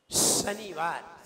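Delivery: slow शनिवार with a long hissed श; whistled श. Shown as a demonstration. Teacher drills a named student, long whistling श in शनिवार. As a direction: hold the sh of शनिवार before the vowel. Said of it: "सही बोलिए".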